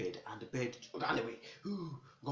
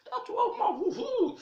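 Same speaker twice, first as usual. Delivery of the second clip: high, slow laughing woohoo; laughing whoop; 8 dB louder than usual. Retold or imitated. Butcher man whooping at the flying bird, laughing. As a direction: laugh it out in delight.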